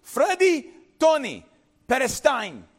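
high, loud names then a stern Perestine; father freezing his sons mid-mischief, commanding call. Retold or imitated. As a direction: call them to freeze on the spot. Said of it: "As soon as you heard my name".

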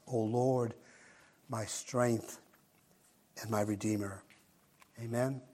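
soft, reverent spoken prayer. Acted. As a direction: pray it quietly and humbly. Opening prayer before the sermon.

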